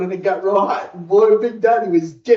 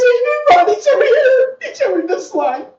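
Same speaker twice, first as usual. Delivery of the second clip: high, loud whiny mock boyfriend; 5 dB louder than usual. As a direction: squeal it like a jealous boyfriend teasing. Mocking jealous boyfriend, whining accusation.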